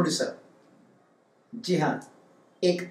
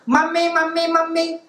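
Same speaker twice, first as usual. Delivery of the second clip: put-on child calling mummy; 8 semitones higher than usual. Maid's little son runs in calling.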